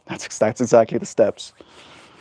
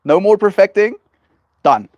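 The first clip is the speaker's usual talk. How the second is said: decisive cutoff; 7 semitones higher, 5 dB louder than usual. Retold or imitated. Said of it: "I'll be like".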